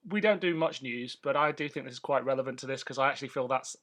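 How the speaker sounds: ordinary talk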